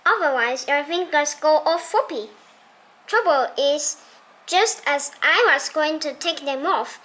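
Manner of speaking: ordinary delivery, nothing marked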